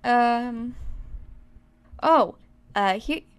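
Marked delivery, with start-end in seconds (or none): none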